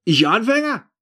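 high, loud disbelieving question; incredulous. A narrator's reading. The thief questioning the narrator's insult, incredulous. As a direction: echo the insult in offended disbelief. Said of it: "fragt er mich ungläubig".

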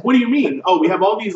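high, loud acted retort; mock confrontation. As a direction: throw it back in a hammed-up quarrel. Acted police confrontation over murder.

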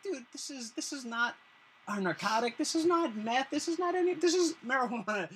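rising pitch as if explaining to a dense cop; exasperated explaining. Pretend. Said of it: "you're not gonna be like".

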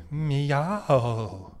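long drawn-out mock meow; cat meow. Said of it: "every cat's voice is just like".

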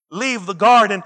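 ordinary delivery

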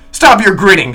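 an angry guest yelling at the landlord; shouted rebuke. Acted. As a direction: shout him down. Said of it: "shouted I".

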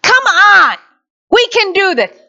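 high, loud, exasperated goading; tough spurring. Acted. Preacher acts out prodding someone to get up, loud urging. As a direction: push them hard to get up. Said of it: "Sometimes it's not a".

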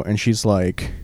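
ordinary delivery

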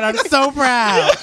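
mock proud. Gushing mock pride.

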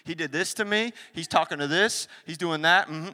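put-on gossiping girlfriend. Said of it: "You get together with your girlfriends".

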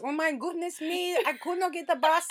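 a hint of a fussy mother; imitating mum. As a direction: play mum chattering into voicemail. Mum's rambling voicemail retold.

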